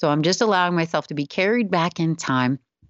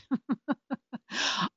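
soft high laugh; 8 semitones higher, 9 dB quieter than usual. Real, not acted. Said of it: "I kinda lost it".

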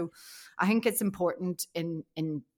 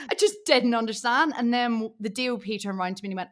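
ordinary delivery

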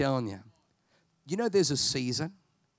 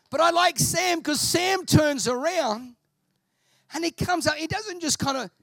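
excited; 4 dB louder than usual. Real, not acted. Preacher gushing to congregation about Sam, high excited voice. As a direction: get carried away with the story. Excited retelling of film scene.

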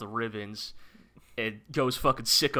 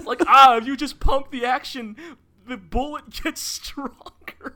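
high, laughing through the words; giggling while explaining; 13 semitones higher, 8 dB louder than usual. Real, not acted. Laughing at shotgun logic.

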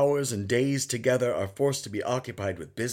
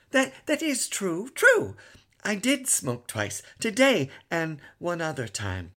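put-on very high eager little boy; cheerful child; 8 semitones higher, 3 dB louder than usual. A narrator's reading. Son cheerfully admits smoking twice to father. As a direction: admit it happily, smiling. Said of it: "his eyes smiled".

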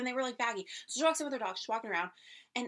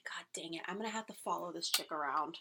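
muttered inner grumble; 6 semitones lower, 6 dB quieter than usual. Retold or imitated. Retold inner grumble at customer.

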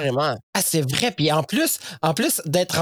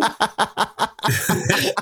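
laughing hard at the memory; hearty laugh; 2 semitones higher than usual. Real, not acted. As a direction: burst out laughing. Laughing at the ending. (rire franc)

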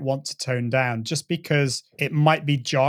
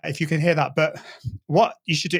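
ordinary talk, nothing marked

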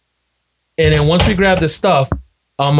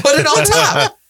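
much higher, swaggering generous uncle; big-shot uncle, high; 14 semitones higher, slower than usual. Retold or imitated. Uncle telling nephew to pile more on, high swagger. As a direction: play the uncle with no limits, pitched way up. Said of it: "I'm like".

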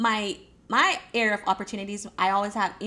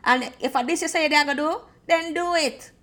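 higher, loud Jamaican patois, firm; 6 semitones higher, 7 dB louder than usual. Retold or imitated. Jamaican friend telling the listener to stick to the goal, in a loud, high Jamaican voice. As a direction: push them hard in a Jamaican accent. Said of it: "Jamaica will say".

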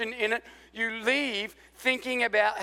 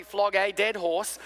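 mock American "flog a dead horse", slow and drawn out; American accent; slower than usual. Shown as a demonstration. Pastor teasing Americans by saying the phrase their way, in an American accent. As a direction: stretch each word out, imitating how Americans say it. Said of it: "You probably say the words properly".

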